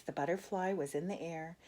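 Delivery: plain reading voice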